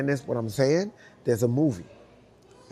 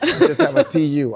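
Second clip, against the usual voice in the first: laughing; 12 semitones higher, 10 dB louder than usual. Real, not acted. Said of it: "I have a tuh".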